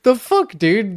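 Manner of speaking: high, loud, fed-up cry; exasperated outburst. Real, not acted. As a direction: let the frustration burst out high. Ranting about broken game features.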